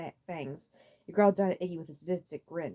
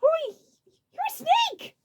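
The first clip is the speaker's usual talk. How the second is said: very high, loud cry; alarmed shout; shocked; 6 dB louder, much slower than usual. A narrator's reading. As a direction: blurt it out in horror. Iggy shocked.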